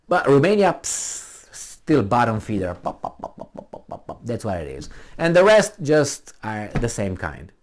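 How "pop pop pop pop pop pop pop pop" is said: breathy unvoiced pops, little puffs of air; mock popping noises. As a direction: puff out tiny pop sounds, dismissive. Mocking noise about Romania.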